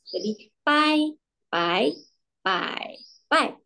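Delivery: teacher repeats pai in four tones; slow tone demo. Shown as a demonstration. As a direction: slowly model each tone of pai.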